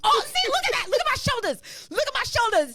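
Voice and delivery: high excited squeal; delighted and loud. Real, not acted. Dancing to the song.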